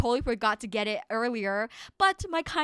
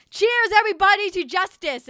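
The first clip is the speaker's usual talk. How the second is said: loud; 8 semitones higher, 8 dB louder than usual. Real, not acted. Toasting viewers.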